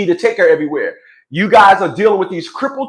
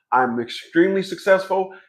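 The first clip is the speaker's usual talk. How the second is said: ordinary delivery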